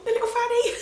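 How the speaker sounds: high, loud delighted squeal on fanny; excited squeal. Real, not acted.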